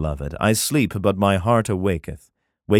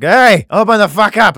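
much higher, louder yell at the door; mock yell; 12 semitones higher, 8 dB louder than usual. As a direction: pound the door and bellow. Lover banging at door.

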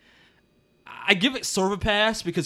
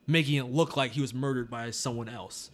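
normal talking voice

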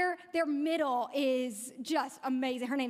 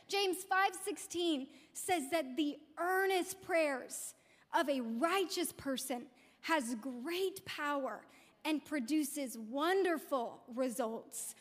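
usual speaking voice

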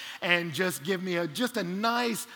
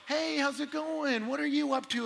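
friendly greeting; 6 semitones higher than usual. Retold or imitated. Young couple greeting a passing hiker, friendly. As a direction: greet him casually, pitched up.

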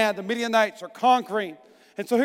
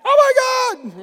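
mock terrified shriek; 13 semitones higher, slower than usual. Pastor mocking his ideal scared reaction, high shriek. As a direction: shriek it in fake terror. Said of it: "I'd be like".

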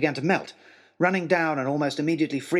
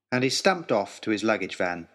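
plain reading voice

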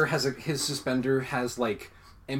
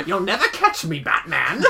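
villain taunt; 5 semitones higher, 5 dB louder than usual. Pretend. Supervillain taunt, laughing.

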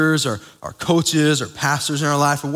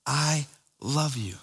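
much softer and slow, gentle; tender I love you; 7 dB quieter, slower than usual. Retold or imitated. Preacher voices God's words to the congregation, soft and slow tender. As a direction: say it like God speaking softly to his child. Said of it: "is speaking to you and me with the words".

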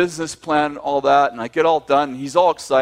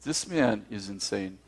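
lower, softer and slow; muttered thought; 6 semitones lower, 12 dB quieter, slower than usual. Retold or imitated. Supplier thinking about the broke dreamer, low mutter. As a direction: mutter it to yourself in disbelief. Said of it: "gets this crazy look".

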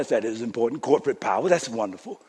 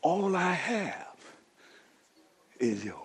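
calm fatherly reassurance; gentle father; 3 semitones lower, 11 dB quieter than usual. Retold or imitated. The father reassuring his oldest son, much softer and gentle. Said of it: "and then he says".

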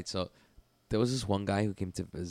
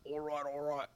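higher, much softer strained mimicry; imitating choked visitor; 6 semitones higher, 12 dB quieter than usual. Mimicking visitor being choked, small high mock tap-out. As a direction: let the repeated words squeeze out faintly.

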